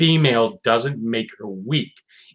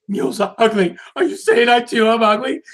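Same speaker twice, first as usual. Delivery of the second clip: offended Rarity; 8 semitones higher, faster than usual. Retold or imitated. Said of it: "It's like".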